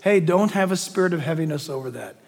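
ordinary talk, nothing marked

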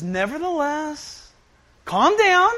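lightly acted reassurance to panicking followers; Jesus calming disciples.

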